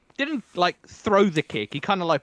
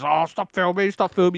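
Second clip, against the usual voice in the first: mock agitated protest, higher and louder; aggy; 6 semitones higher, 4 dB louder than usual. Agitated passer-by objects to being filmed, mock aggy. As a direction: imitate someone getting aggy at being filmed. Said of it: "the person gets aggy with this".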